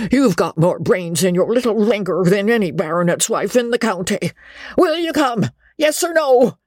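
put-on an old man pleading for her return; pleading old baronet. A narrator's reading. Baronet proposes eagerly.

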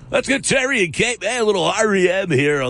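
mock the slick radio jock voice. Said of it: "How does everybody talk like that?".

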